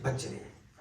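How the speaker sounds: unvoiced, mocking repeat; whispered echo. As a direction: breathe it out like a secret. Mimicking the old woman's reply. (سرگوشی میں)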